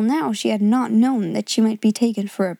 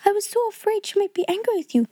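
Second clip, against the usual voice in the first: put-on much higher, anxious little girl; worried girl; 10 semitones higher, faster than usual. A narrator's reading. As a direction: fret over what might have gone wrong. Janet worries aloud.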